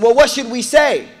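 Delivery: high, loud scheming elders; Quraysh leaders scheming. Retold or imitated. Preacher voicing plotting Quraysh chiefs, high and loud.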